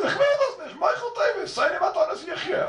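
very high-pitched mock protest; acted refusal. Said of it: "If the guy says".

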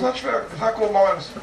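put-on high, whiny child's protest; mock whining. Teacher mocking a terse ruling as a whiny complaint. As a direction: whine it like a kid denied something.

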